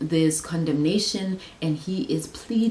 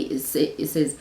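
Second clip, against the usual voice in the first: ordinary delivery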